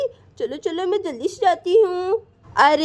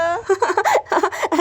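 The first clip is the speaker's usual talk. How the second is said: शरारती हँसी. Acted. Siya's mother laughs to herself.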